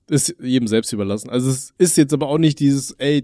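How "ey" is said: ordinary talk, nothing marked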